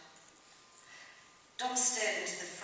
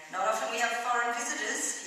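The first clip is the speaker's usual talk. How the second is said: higher, louder matey remark; playful wink in the voice; 5 semitones higher, 6 dB louder than usual. A narrator's reading. Landlord to returning local, playful. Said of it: "he winked".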